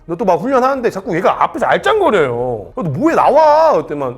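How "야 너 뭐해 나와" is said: high, loud officer's shout, building; sharp rising mock yell of command; retold yell. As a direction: shout him out of the way, acted. Retold yelling at soldier during training.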